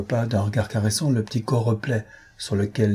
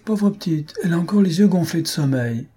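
light, much higher-pitched teasing; laughing remark; 8 semitones higher than usual. A narrator's reading. Husband fondly pitying his sleepy wife, lightly amused. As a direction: say it high, as if amused. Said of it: "il reprit en riant".

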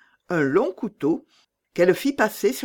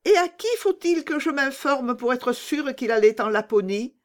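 put-on higher, hopeful little girl; young girl Gerda; 9 semitones higher than usual. Gerda asks.

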